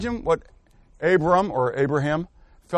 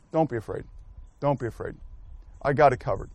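soft, low and gentle, calming a frightened man; God reassuring; 6 semitones lower, 9 dB quieter, faster than usual. Retold or imitated. Teacher voicing God comforting afraid Abram, soft and low. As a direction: hush him like a worried friend, quietly. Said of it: "And God comes and says".

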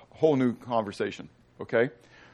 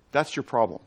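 normal talking voice

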